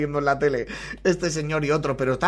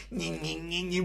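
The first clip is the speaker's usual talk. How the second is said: squeaky laughter; 10 dB quieter, slower than usual. Real, not acted. Helpless laughter after the clip.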